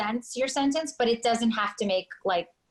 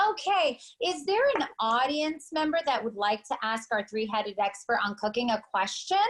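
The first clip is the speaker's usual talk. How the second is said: ordinary talk, nothing marked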